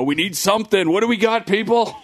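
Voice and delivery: mock panicked TBS intern; frantic intern. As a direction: play a flustered TV intern scrambling for a fix. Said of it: "some intern at T B S go".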